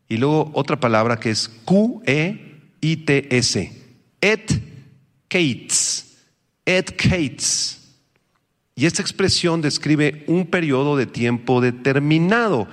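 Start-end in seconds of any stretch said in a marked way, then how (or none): 4.2-7.8 "et kates" said slowly as et KATES; Hebrew pronunciation. Shown as a demonstration. Teacher showing his class Hebrew "et kates", slow and careful. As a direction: spell out "et kates" syllable by syllable. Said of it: "Voy a darles la palabra hebrea".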